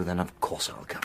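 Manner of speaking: soft unvoiced whisper of loyalty; whispered promise. Devoted friend whispering his promise to come along. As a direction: breathe the promise, barely voiced.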